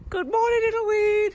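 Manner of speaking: high loud sing-song hello; sing-song greeting. Real, not acted. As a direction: coo at the little plant.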